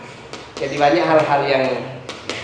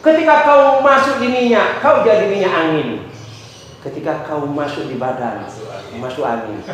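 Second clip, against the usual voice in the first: poem recital. Shown as a demonstration. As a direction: recite it dramatically, drawing out each line. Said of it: "saya membacakan puisi judulnya Angin".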